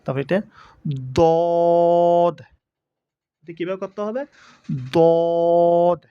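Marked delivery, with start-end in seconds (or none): 1.2-6.0 দদ loud, with heavy d and long level o; correct দদ. Shown as a demonstration. Teacher shows how it must be done, loud sustained দদ. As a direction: hold the round vowel of দদ on one note. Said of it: "আমি যেভাবে বলেছি".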